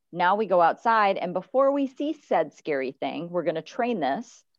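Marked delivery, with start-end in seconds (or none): none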